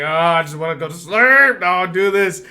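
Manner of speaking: high and loud, laughing through it; laughing panic. Retold or imitated. Hearing the door open, laughing in mock panic, high and loud. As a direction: squeal it out half-laughing.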